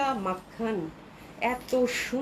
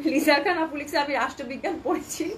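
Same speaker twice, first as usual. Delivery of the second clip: reading aloud through real giggles; laughing; 3 semitones higher than usual. Real, not acted. Seller reading a viewer's comment on live stream, laughing. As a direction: try to read while cracking up.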